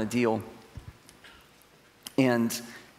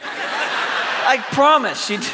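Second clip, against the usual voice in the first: breaks into laughter mid-line, high and slow; can't finish for laughing; 12 semitones higher, 6 dB louder, much slower than usual. Real, not acted. Telling the church about the scary flight, laughing.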